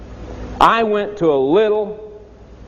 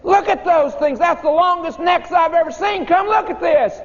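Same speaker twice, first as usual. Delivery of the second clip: put-on excited kids calling; 12 semitones higher than usual. Schoolkids urging the teacher to see lions, high and eager. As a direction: beg him to come look. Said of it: "and we said".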